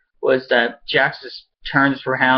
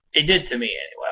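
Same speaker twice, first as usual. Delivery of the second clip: ordinary talk, nothing marked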